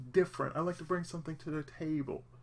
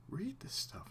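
whispered, higher, under the breath; 9 semitones higher, 8 dB quieter than usual. Shown as a demonstration. Showing how he used to read silently, whispered. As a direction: whisper it like reading to yourself. Reading to himself, demo.

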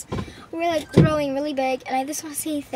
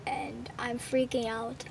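excited whisper; 4 semitones lower, 10 dB quieter than usual. Real, not acted. Kid overwhelmed by subscriber news.

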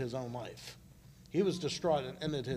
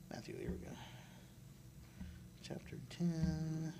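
whispered aside to himself; real whisper; 15 dB quieter than usual. Real, not acted. Preacher pausing to find his page, whispering. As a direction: breathe it under your breath.

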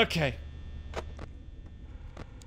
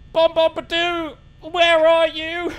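sing-song calling; 7 semitones higher, 6 dB louder than usual. Real, not acted. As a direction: call out teasingly, higher. Calling the animatronic.

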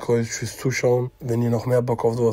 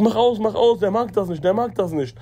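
higher-pitched, urgent repeated plea; retold agitated plea; 7 semitones higher than usual. Scared friend begging the narrator to stop the Koran, urgent. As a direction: imitate a panicked friend, held back. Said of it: "der hat mich so angeschrien".

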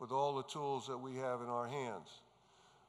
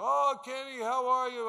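put-on cheerful old father; 8 semitones higher, 7 dB louder than usual. Father voiced in retold phone call.

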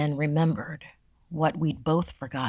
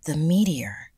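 hushed. Acted. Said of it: "said in unison".

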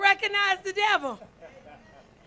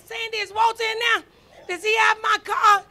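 louder, urgent demanding call; retold shout; 3 semitones higher, 8 dB louder than usual. Preacher retelling calling her friend about the car, urgent shout. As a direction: yell it like your car is missing.

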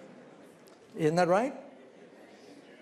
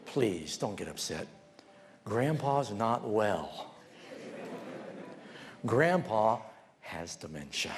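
lowered, gentle explanation; soothing host; 5 semitones lower, 3 dB quieter, slower than usual. Acted. Host calming an upset guest, acted gentle reassurance. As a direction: break it to them slowly and softly.

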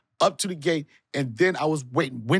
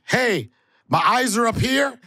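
mock offended; 9 semitones higher, 5 dB louder than usual. Mock offended at host's stare.

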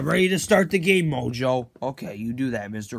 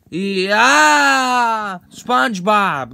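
loud, long, higher cheer; triumphant yell; 4 semitones higher, 8 dB louder, much slower than usual. Acted. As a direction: cheer out in victory.

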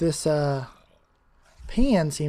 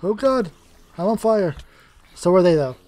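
panicked; 3 semitones higher, 7 dB louder than usual. Real, not acted. On fire in the game.

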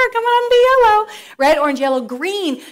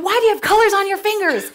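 annoyed interpreters; 8 semitones higher, 3 dB louder than usual. Retold or imitated. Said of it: "They're like".

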